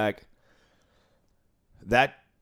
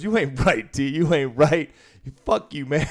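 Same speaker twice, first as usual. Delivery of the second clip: a touch louder and higher, playful jab; mock teasing retort; 5 semitones higher, 4 dB louder than usual. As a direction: toss it back with a grin. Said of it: "And he goes".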